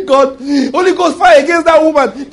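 loud high hammed-up prayer; mock fiery prayer. Preacher mocking believer praying fire on a woman, loud. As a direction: shout the prayer like a fierce believer.

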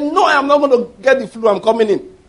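high, loud quoted refusal; defiant insistence. Retold or imitated. Visiting man of God answers the people at the door, loudly defiant.

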